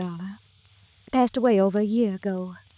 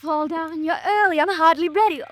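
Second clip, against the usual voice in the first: eager greeting; 7 semitones higher than usual. Acted. Greeting expected lover at door.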